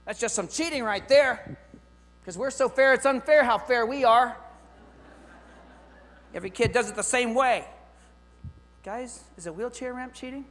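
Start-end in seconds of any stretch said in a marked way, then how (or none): 0.1-7.7 loud, higher, scornful mock protest; hammed-up indignant voice of the people crying foul; mocking complainers. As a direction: sneer it like an outraged critic. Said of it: "People are gonna get mad".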